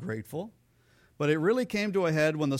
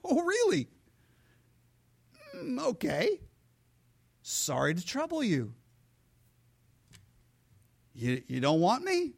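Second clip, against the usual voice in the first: a lightly voiced, mock-hurt God; God's hurt, sarcastic reply; 11 semitones higher than usual. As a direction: lightly voice God, feigning offense. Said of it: "And God looks down and goes".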